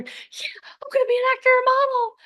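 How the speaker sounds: very high, giddy squeal; hammed-up shrieky excitement; emotional brain. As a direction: squeal it like a starstruck hopeful. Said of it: "your emotional brain gets all excited".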